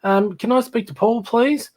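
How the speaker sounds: mock-courteous friend on the phone; polite caller. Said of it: "And Pete would say".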